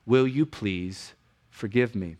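soft, slow, humble request for forgiveness; humble plea. Shown as a demonstration. Husband to offended wife, softly and humbly asking forgiveness. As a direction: ask for forgiveness quietly and humbly. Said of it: "I want the humility to say".

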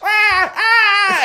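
put-on high shouted baby wail; tiny man wailing like a baby; loud wailing cry. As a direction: wail like a screaming infant. GM voices crying tiny man.

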